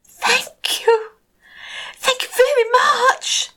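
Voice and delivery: very high, joyful thanks; delighted little fish. Acted. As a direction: gush with happy gratitude.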